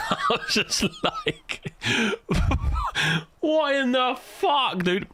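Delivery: high, slow, laughing "what in the fuck"; laughing disbelief. Retold or imitated. Retelling the car story to friends, laughing incredulously. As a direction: squeal it out through laughter, stretched slow.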